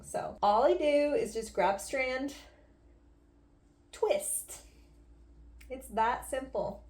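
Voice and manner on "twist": high, breathy-quick "twist" sound effect; playful high-pitched twist noise. Shown as a demonstration. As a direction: say it as a high little swishing noise. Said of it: "make the twist noise like that".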